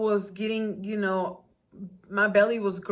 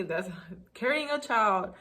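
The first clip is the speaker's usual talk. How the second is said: ordinary talk, nothing marked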